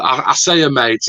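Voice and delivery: ordinary talk, nothing marked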